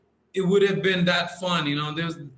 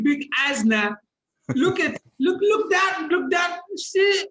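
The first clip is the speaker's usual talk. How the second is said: put-on Nigerian accent; 5 semitones higher than usual. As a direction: boom out a mock Nigerian accent demanding respect. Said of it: "do the Nigeria".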